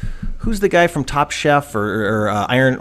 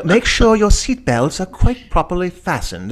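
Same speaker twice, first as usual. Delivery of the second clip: imitating a prim British accent, higher-pitched; fake British accent; 5 semitones higher than usual. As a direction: put on a mock British safety-video voice. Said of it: "she's in this, like, British accent".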